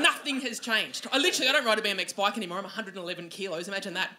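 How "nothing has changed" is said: ordinary talk, nothing marked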